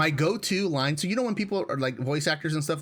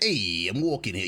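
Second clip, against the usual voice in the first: New Yorker; 6 semitones lower than usual. Retold or imitated. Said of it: "big New Yorker vibes".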